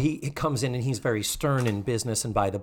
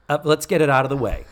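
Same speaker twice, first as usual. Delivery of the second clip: ordinary delivery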